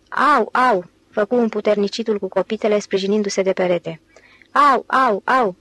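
moaning ow ow as if from a neuralgia; pained yelps. Acted. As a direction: groan in real pain, leaning on the wall. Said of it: "fața strâmbată ca de o nevralgie chinuitoare". (gemete de durere, „au, au”)

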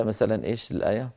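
ordinary talk, nothing marked

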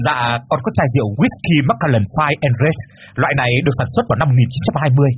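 rapid boastful patter; glib fast talk. Acted. Rich suitor brags to parents about whisky, rapid glib patter. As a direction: rattle off the brag quickly. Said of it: "mau mồm".

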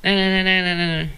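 high, loud calling out like an angry boss; mock boss yelling. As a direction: bark nè at him like a cranky boss. Bà boss gọi vào chửi. (giả giọng bà chủ quát to)

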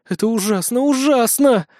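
high-pitched, aghast repetition; appalled exhaling. A narrator's reading. Witch appalled by the boy's fantasy, aghast. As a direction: breathe it out in dismay, rising in pitch. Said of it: "выдохнула она".

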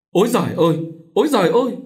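high, loud cry of pain; wailing cry. A narrator's reading. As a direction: cry out to heaven, pitch climbing. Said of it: "Cô rú lên". (rú lên kêu trời)

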